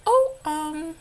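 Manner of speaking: high, loud mock agreement; humouring reply. Vlogger replaying her answer to the girls, humouring. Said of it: "It's like".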